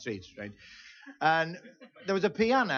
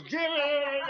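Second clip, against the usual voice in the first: bravado; 10 semitones higher, 5 dB louder than usual. Retold or imitated. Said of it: "the old bravado's".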